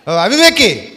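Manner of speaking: ఓ అవివేకి called out loud and stern, falling hard; an apostle's sharp loud rebuke, O fool. Retold or imitated. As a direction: rebuke the fool so he stops short. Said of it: "అనేసరికి అవతలోడు కొంచెం ఆగుతాడు".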